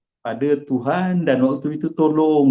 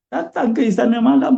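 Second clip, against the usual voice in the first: ordinary talk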